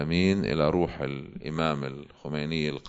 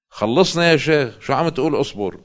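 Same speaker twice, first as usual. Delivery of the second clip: high, loud mock exasperation; impatient retort; 8 semitones higher, 7 dB louder than usual. Fed-up listener answering a sheikh who preaches patience, impatient. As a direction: cut the sheikh off, acting fed up and raised in pitch.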